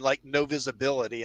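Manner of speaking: usual speaking voice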